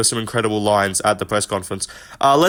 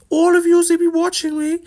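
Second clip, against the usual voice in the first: mock near-tears. Mocking Ian Garry getting booed, whiny near-tears. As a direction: squeak it out like you're about to cry. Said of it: "sounded like he was gonna start crying".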